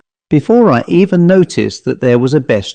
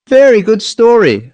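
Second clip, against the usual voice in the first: dapper man; 10 semitones higher than usual. A narrator's reading. Smart little man by fire.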